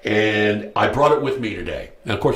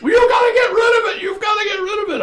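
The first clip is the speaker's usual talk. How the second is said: loud, high, fast yelling; shouting; 4 dB louder, faster than usual. Retold or imitated. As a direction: bellow the AA rule. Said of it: "they tell you".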